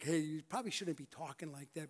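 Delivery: understated, softly spoken reproof; gentle correction. Acted. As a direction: take them aside and tactfully nudge them.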